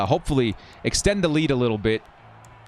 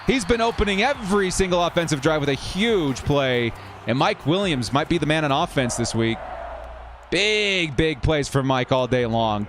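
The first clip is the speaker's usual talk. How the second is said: live play-by-play; 4 semitones higher than usual. Real, not acted. Commentator calls a long pass to viewers, excited play-by-play. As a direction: pitch climbing as the play breaks open.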